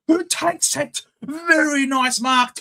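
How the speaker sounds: ordinary talk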